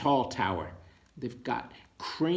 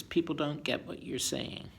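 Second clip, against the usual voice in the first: ordinary delivery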